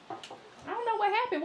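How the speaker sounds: usual speaking voice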